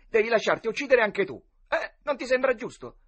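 high, loud insistence; boyish demand. A narrator's reading. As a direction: press your claim for a turn. Boy demands his turn. (pretesa insistente da ragazzino)

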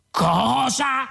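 high-pitched yell, volume held back. A narrator's reading. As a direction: yelp it high in disbelief. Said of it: "urlò Ford".

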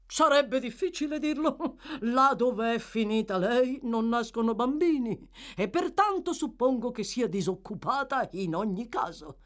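messo pomposo e ironico, voce alta e forte; voce di Bumble faceto. Acted. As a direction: scherza con gusto grazie al gin. Bumble, made jocular by gin. (Bumble brillo scherza con lo sconosciuto, voce alta)

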